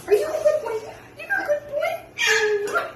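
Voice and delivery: high cooing pet talk; talking to a dog. Real, not acted. Owner coos at pet.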